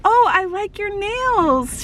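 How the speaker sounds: high, drawn-out cooing idol voice; imitated idol, swooping high; idol's compliment. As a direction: coo an admiring compliment, slowly.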